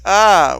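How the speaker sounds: imitated cry on being bonked; sudden acted yelp; mock pained yell. Imitates Taylor being hit.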